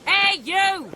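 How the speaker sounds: loud, high hail; calling out. Acted. Thug hails the witcher with a loud, high shout. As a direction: shout to grab attention across the street.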